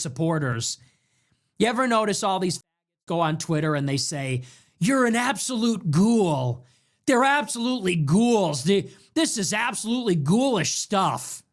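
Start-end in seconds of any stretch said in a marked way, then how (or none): 4.8-11.4 mock outraged right-wing poster. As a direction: imitate pearl-clutching online outrage. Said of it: "They all talk like this".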